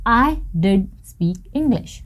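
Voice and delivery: slow, stressed "did" in I did speak English; emphatic did. Shown as a demonstration. Teacher modelling emphasis for students, slow stressed did. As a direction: lean on did, slowly. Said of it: "तो आपने क्या कहा".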